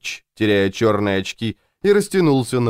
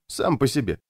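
usual speaking voice

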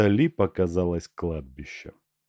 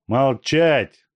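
higher, louder command; barked order; 6 semitones higher, 6 dB louder than usual. A narrator's reading. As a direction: shout him down. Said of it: "крикнул полицейский". (громкий окрик)

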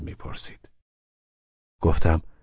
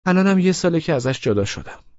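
put-on much higher young woman's voice; Laleh's voice; 12 semitones higher, 4 dB louder than usual. A narrator's reading. Laleh telling Abbas she divorced a year ago, pitched high. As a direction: tell him you're divorced, lightly.

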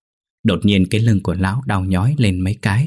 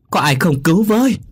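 high-pitched panicked call; stretched, high plea in terror; frightened cry for help; 10 semitones higher, 3 dB louder than usual. A narrator's reading. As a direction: cry out for someone to save you. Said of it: "Quá sợ hãi".

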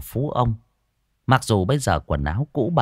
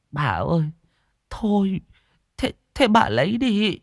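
lightly voiced old woman yielding; resigned old woman; 11 semitones higher than usual. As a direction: give in with a sigh.

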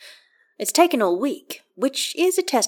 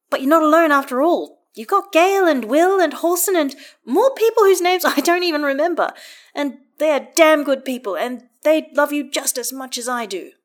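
higher and louder, full-hearted reassurance; Karlach, emphatic; 6 semitones higher, 4 dB louder than usual. A narrator's reading. Karlach telling her friend he is not alone, emphatic and higher. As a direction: lift it up, insist he is loved.

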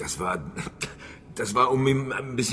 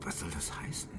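hushed; 8 semitones lower, 15 dB quieter than usual. Acted. Shopkeeper reacts to veiled accusation.

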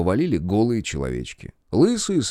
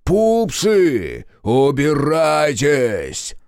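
loud, high, very drawn-out call; shouted command; 5 semitones higher, 6 dB louder, much slower than usual. A narrator's reading. Captive hussar calling off his troops, shouted command. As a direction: holler the order slowly across the valley.

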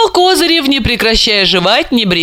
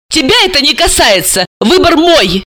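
shout; 10 semitones higher than usual. Acted. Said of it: "закричала".

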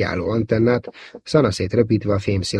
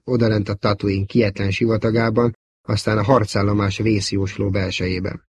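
ordinary delivery, nothing marked

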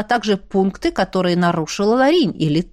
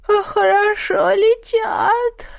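drowsy, slow, high otter voice; sleepy yawning; 11 semitones higher, much slower than usual. Acted. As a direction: yawn through the words, slowly. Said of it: "сладко зевнуло".

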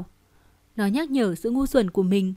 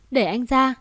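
usual speaking voice

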